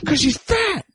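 very high-pitched, squealed interjection; silly exclamation. Pretend. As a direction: blurt it out way up high. High silly interjection about Faith.

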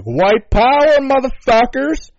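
loud, high mock shout; hammed-up bigot cheering. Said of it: "being like".

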